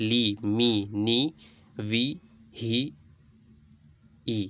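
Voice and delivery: bare Arabic syllables, each with long ee; reading without spelling. Shown as a demonstration. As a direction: recite each syllable separately, holding the vowel. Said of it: "এবার বিনা বানানে পড়তে হবে".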